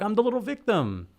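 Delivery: sarcastic pity-me voice; mock victim voice. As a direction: play the poor little victim, mocking him. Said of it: "play this card like he's the little underdog".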